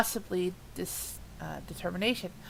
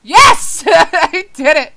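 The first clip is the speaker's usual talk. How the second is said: high, loud victory shout with laughter; triumphant scream; 7 semitones higher, 15 dB louder, slower than usual. Real, not acted. Reader finally getting through the line, real triumphant scream. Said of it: "just so I can scream yes".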